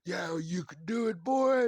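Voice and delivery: put-on hearty encouraging cheer rising on boy; mock-kindly neighbor cheering a kid; friendly old neighbor. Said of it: "grumpy old man role ... change".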